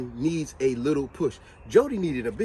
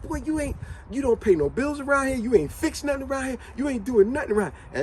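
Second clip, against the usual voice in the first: scolding mother; 6 semitones higher than usual. Retold or imitated. Mother scolding Jody in movie.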